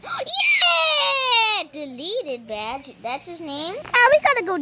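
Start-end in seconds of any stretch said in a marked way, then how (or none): 0.0-1.6 gasp, then high loud cheer; long, high victory yell; triumphant shout. Real, not acted. Player reaches the exit for a badge, triumphant shout.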